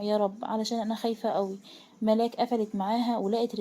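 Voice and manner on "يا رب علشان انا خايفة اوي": usual speaking voice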